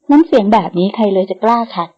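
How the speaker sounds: plain reading voice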